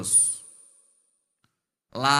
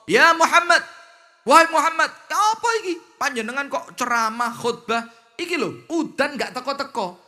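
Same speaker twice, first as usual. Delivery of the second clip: high, loud rough Bedouin yelling; 7 semitones higher, 4 dB louder than usual. Acted. Badui shouting at Nabi Muhammad during the sermon, loud and high. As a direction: barge in yelling at the preacher.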